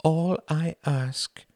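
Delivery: high, very slow appeal; drawn-out, careful plea; slowly. A narrator's reading. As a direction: spell out the request word by word. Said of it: "said Wendy slowly".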